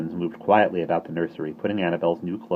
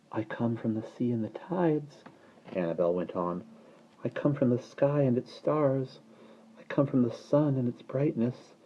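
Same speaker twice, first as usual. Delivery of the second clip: soft recitation; 6 semitones higher, 5 dB quieter than usual. Acted. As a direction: recite like a lullaby. Baby recites origins.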